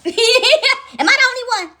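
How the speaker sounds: laughing. Real, not acted. Laughing at her own habit.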